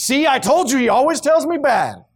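high, emphatic acted grievance; indignant complaint. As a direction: complain loudly like a vindicated king. Acted king complaining to the other king.

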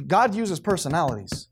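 usual speaking voice

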